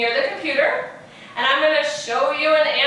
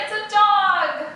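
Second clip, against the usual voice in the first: normal talking voice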